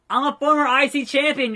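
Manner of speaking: high-pitched, worked-up appeal; indignant protest. Acted. Wrestler protesting to boss, indignant.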